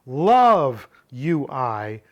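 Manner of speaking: "Love" drawn out very long and loud; stress on Love. Shown as a demonstration. Teacher putting the verb first, stressed "Love". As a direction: punch and stretch "Love", then let "you I" drop. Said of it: "put the verb first".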